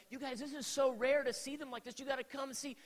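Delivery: retold dad pleading with reluctant kids; agitated. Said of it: "I'm, like, getting more agitated".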